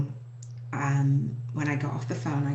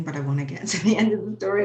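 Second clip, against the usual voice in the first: higher, quick words with a small laugh; laughing through emotion; 5 semitones higher, 4 dB louder, much faster than usual. Real, not acted. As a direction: laugh lightly to push past the tears. Laughs while trying not to cry.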